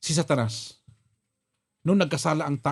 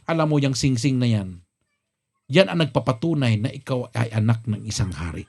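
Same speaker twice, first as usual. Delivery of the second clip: put-on grave older brother; 8 semitones lower than usual. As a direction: explain it like a wise elder. (mababa at seryosong boses ng kuya)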